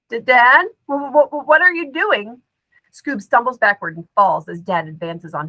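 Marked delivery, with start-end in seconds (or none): 0.1-2.4 terrified stammer. A narrator's reading. Terrified stammer at advancing father.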